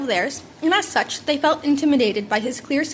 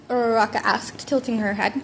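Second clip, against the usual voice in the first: ordinary delivery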